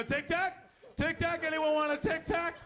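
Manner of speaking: high-pitched pestering offer; eager mint-offerer. Retold or imitated. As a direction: mimic someone pushing mints on everyone. Acting out an eager Tic Tac offerer.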